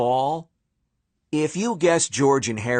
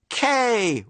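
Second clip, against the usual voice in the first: crowd response; 9 semitones higher than usual. Acted. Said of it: "repeated the fans".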